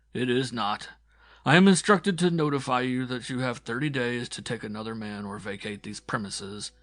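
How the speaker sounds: a hint of a reluctant messenger; young guard, formal notice. A narrator's reading. Guard delivers official notice.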